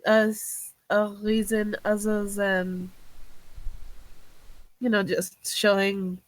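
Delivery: slow, halting question with restarts; struggling for words. Acted. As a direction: feel your way through the question. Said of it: "she's struggling to find the words".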